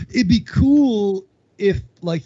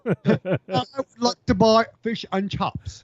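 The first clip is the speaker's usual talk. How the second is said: mock British accent; 3 semitones higher than usual. Joke British accent, laughing.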